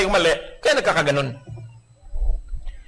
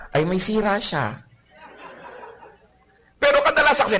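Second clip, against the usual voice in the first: normal talking voice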